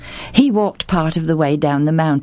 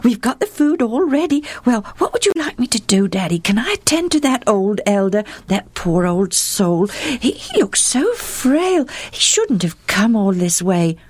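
put-on high, breathless little girl's voice; excited girl searching for words; young daughter; 8 semitones higher than usual. A narrator's reading. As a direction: play the eager, stammering daughter. Daughter voice again.

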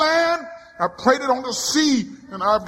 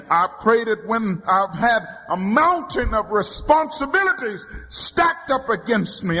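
normal talking voice

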